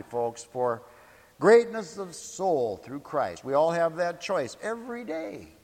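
ordinary delivery

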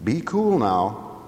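slow, steadying advice to oneself; mild calming self-talk. Acted. The smarter self calming Simon down. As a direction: talk yourself down gently. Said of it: "he talks to his smarter self".

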